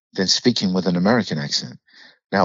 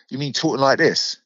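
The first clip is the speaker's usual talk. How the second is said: higher-pitched British accent; 5 semitones higher than usual. Shown as a demonstration. Actor asked by host for his British voice, British accent. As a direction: put on your British voice for the question. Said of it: "in your British voice".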